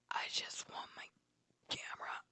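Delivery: breathy whisper, barely there. Retold or imitated. Narrator whispers her plea to her attacker. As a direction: whisper it to the one attacking you. Quietly asking attackers for camera.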